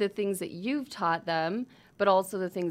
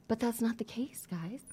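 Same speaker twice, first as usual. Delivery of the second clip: hushed whisper; 7 dB quieter than usual. Real, not acted. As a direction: drop to a whisper. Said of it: "Are you whispering 'cause the baby's sleeping".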